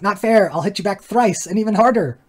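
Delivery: put-on high, indignant kid's threat; mock childish outrage. The other kid protests and threatens back, high and indignant. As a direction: whine it like a kid promising payback.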